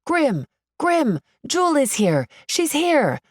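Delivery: put-on high-pitched teenage fans calling out; eager, high mild shout; excited shouting. A narrator's reading. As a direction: shout to get his attention. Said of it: "teenagers behind me start shouting".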